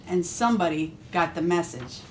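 plain reading voice

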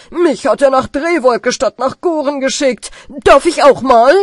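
loud indignant doctor; shouting. Acted. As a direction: shout your grievance. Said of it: "schreit der Doktor".

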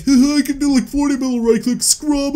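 high-pitched, loud mock bragger; mocking other players. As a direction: put on a high, bragging gamer voice to mock them. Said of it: "a lot of other people are like".

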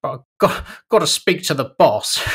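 high, loud mock-jovial customer; laughing car buyer. Customer stalls the salesman by blaming the wife, loud forced joviality. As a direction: imitate a buyer laughing off his excuse. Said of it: "like, always, like, laugh, noticing".

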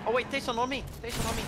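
loud, high warning shout; urgent call. Real, not acted. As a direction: shout for your teammate to come. Enemy attacking, calls teammate.

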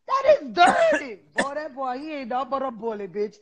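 ordinary talk, nothing marked

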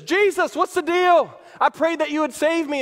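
high, loud protest; acted, hammed-up grievance; indignant complaint. Acted man complaining in heaven.

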